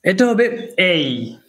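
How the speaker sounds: "এই" said as a clear diphthong "ay"; name the letter A as "এই", not "এ"; corrected letter name. Shown as a demonstration. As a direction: glide "এই" from e to i like "ay". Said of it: "genuine বা authentic প্রনাউন্সিয়েশনটি".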